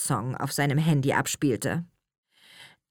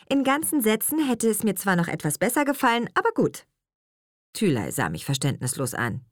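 usual speaking voice

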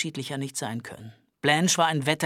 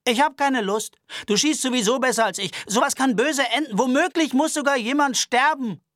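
put-on higher, anxious, aggrieved young man; high-pitched nervous Albert voice; 10 semitones higher, 6 dB louder than usual. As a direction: complain fussily and fast. Albert declines the duel.

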